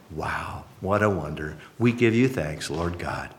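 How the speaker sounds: low, soft, reverent prayer; closing prayer. Real, not acted. Pastor thanks God and asks for power, softly prayerful. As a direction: pray quietly and earnestly, with long pauses.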